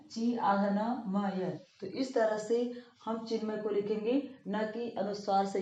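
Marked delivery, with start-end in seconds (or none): 0.1-1.5 slow, letter-by-letter spelling of ची आधा न म य; spelled out slowly. Shown as a demonstration. Teacher spells the word for pupils, slow and deliberate. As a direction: break Chinmay into its letters slowly. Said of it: "जैसे मैंने यहाँ पे लिखा है".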